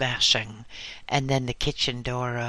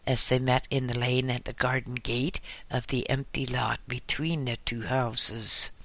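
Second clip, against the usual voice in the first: ordinary delivery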